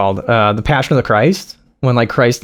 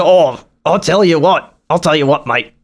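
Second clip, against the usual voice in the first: a light Scottish accent impression. Imitating Critical Drinker.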